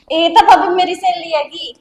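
loud, hurried cover story; flustered excuse. Acted. Caught girl insists loudly to her sister-in-law, flustered. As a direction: blurt the lie out too loudly.

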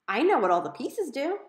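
put-on a kid insisting she knows; eager kid. A narrator's reading. Little sister defending herself to her brother, high and eager.

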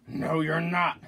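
troll voice. Acted. Troll refusing the second goat, loud menacing voice. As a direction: refuse loudly like a mean troll. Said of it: "said the troll".

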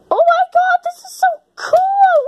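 squeal, then stretch it out; ecstatic. Acted. Character getting a glowing ball, ecstatic.

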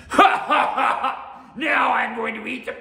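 loud, high laughing shout; triumphant ogre laugh. A narrator's reading. Oni thinking the samurai is gone, laughing in triumph. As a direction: cackle hugely, then crow the threat. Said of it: "he started laughing".

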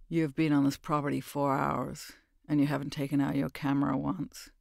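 flat menace, never raising the voice; lightly voiced stern man.

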